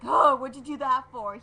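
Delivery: loud mock-indignant outburst; mock outrage, blurted loud; indignant protest. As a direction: act out a wounded, loud complaint. Acting out punched Damon's protest.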